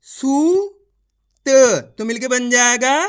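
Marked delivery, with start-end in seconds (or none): 0.1-1.5 सूत with the ऊ stretched very long and high; drawn-out long u. Shown as a demonstration. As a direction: pull the vowel in सूत out slowly. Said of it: "खींच के पढ़ेंगे".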